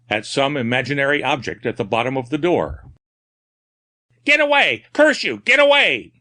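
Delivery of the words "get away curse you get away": high, loud terrified yelling; frantic cry. A narrator's reading. Madman yelling at the imaginary dog, frantic terror. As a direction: scream at the phantom dog in terror. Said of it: "he cried frantically".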